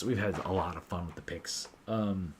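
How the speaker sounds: usual speaking voice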